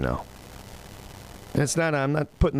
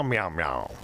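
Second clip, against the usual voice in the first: low, soft gruff meowing; pirate cat meow; 5 semitones lower, 4 dB quieter than usual. Pretend. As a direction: growl the meows low and soft. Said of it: "National Meow Like a Pirate Day".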